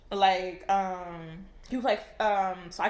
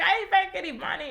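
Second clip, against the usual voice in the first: high-pitched imitation of an upset girl; retold plea on the verge of tears; mock tearful protest; 9 semitones higher, 4 dB louder than usual. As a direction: act out her distress, pitched up. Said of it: "on the verge of tears".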